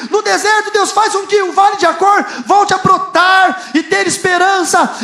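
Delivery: loud, high, fervent sermon cry; preaching at full force. Acted. Pastor rousing the church about the valley of Achor, loud and fervent. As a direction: preach it out to the congregation with fire.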